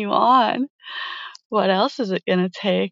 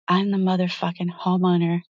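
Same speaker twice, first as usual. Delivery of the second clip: ordinary talk, nothing marked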